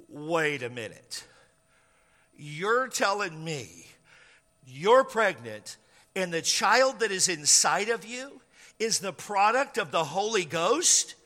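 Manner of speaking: drawn-out sceptical questioning; incredulous. Acted. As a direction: spell out the claim as if unbelievable. Joseph incredulously repeats Mary's claim.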